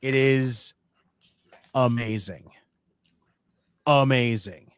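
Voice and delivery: spaced-out, savored declaration; emphatic, word by word. Real, not acted. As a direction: leave a pause after each word for weight. Gushing about Ricochet, word by word.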